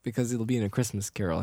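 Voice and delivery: normal talking voice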